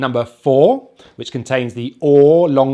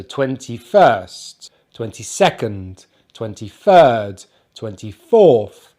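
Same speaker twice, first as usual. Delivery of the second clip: slow "twenty-first" with FIRST drawn out; weight the second word, as in "twenty-fourth"; stress on the ordinal; 3 semitones lower, slower than usual. Shown as a demonstration. Teacher modelling stress for learners, ordinal stressed as in "twenty-third". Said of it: "we stress the ordinal number".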